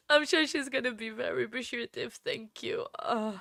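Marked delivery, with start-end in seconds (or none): none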